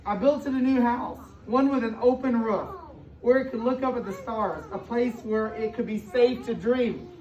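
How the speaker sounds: expressive storybook read-aloud to a class; reading to children. A narrator's reading. Teacher reading a picture book to her class, storybook voice.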